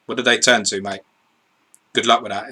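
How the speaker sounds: usual speaking voice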